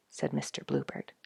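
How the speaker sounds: ordinary delivery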